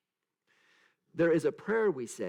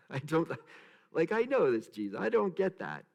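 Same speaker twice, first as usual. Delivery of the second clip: normal talking voice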